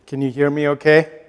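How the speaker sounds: usual speaking voice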